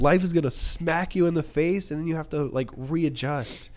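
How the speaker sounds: high-pitched mock lecture to youngsters; exasperated pleading. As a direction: plead urgently, as if lecturing teenagers. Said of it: "I just wanna be like".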